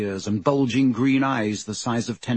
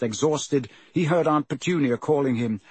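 ordinary delivery, nothing marked

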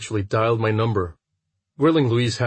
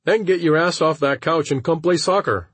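bossy friend voice; 7 semitones higher than usual. Acted. Friend urges him out.

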